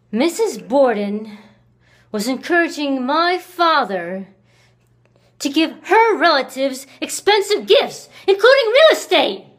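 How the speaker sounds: indignant, rising sharply in pitch and volume; mounting indignation. Acted. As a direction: let the grievance climb to a near shout. Grievance over money rising.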